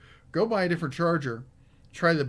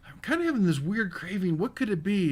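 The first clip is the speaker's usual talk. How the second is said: higher-pitched puzzled wondering; puzzled craving; 5 semitones higher than usual. Acted. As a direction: act out wondering about an odd craving.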